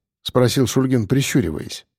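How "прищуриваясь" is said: ordinary delivery, nothing marked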